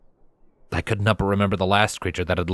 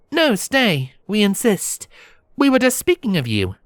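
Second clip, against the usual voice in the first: high, silvery princess voice; 12 semitones higher than usual. Acted. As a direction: invite him to stay in a silvery high voice. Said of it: "the stranger explained with a silvery voice".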